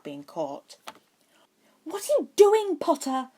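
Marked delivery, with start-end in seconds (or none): 1.8-3.3 higher, louder impatient demand. A narrator's reading. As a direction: hurry him along, fed up. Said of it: "Pansy asked impatiently".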